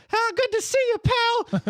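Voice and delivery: tiny, high cookie voice; little voice. Acted. Gingerbread man popping out of the shadows, tiny high voice. Gingy greeting Santa.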